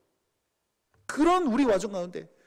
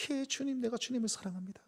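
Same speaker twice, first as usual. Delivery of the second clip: much softer, subdued reply; ashamed hushed answer; 10 dB quieter than usual. Acted. Peter answering Jesus in a quiet, ashamed voice. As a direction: confess love barely above a murmur.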